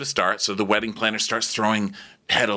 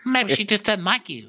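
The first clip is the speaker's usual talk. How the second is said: higher teasing voice; imitating Madison; 11 semitones higher than usual. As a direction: tease him in a high mock voice. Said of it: "And she goes".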